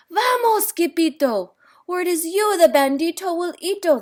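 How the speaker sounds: high, urgent shouted warning; panicked cry. A narrator's reading. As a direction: yell it while fleeing. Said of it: "they cried".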